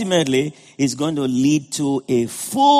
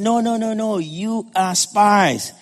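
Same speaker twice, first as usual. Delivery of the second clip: slow, higher mock-stern denial of a governor; harsh accusation; 4 semitones higher, slower than usual. Preacher voicing Joseph as he harshly accuses his brothers. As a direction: lean into each no, then drag out spies. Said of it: "he was so harsh to them".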